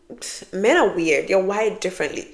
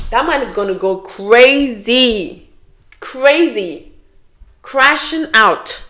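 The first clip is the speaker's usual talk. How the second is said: slow, louder, climbing higher to a peak; rising excitement; 4 semitones higher, 4 dB louder, much slower than usual. Real, not acted. To viewers, gleeful about men crashing out. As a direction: build it up with glee.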